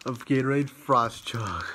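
breathless slow whisper after chugging; out of breath. Real, not acted. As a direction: gasp it out, winded and slow. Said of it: "Man, I'm out of breath".

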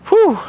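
high exhausted exhale; breathless whew. Real, not acted. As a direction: blow out hard after exertion. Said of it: "out of breath as I am".

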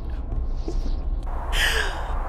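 very high unvoiced gasp; a long sharp high intake of breath; big gasp. Real, not acted. Gamer startled by a cutscene, long high gasp.

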